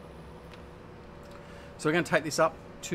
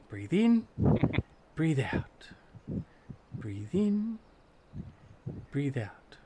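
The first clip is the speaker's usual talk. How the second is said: mock meditation; 3 dB quieter than usual. Mock relaxation guide, laughing.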